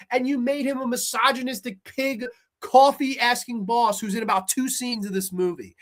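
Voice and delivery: usual speaking voice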